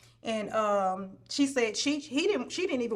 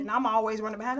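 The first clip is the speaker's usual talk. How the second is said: ordinary talk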